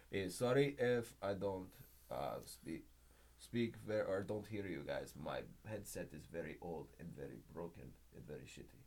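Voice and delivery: put-on low, quiet Russian-accented excuse; soft, deep Russian accent, feigning trouble speaking. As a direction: haltingly apologize in a mock Russian accent. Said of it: "for the Russian language".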